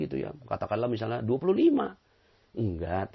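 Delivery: ordinary delivery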